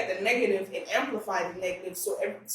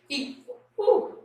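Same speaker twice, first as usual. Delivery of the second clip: choked, about to cry; close to tears; 12 semitones higher, 4 dB louder than usual. Real, not acted. Guest tearing up while praising her mentor. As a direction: voice jumps high, fighting back tears. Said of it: "I'm about to cry".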